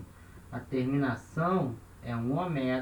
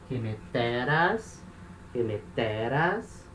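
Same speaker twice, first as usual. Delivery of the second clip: slow "remeteras", weight on TE, rising end; accent shifted one syllable; much slower than usual. Shown as a demonstration. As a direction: stress "remeteras" on TE, very drawn out. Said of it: "o acento anda uma casa".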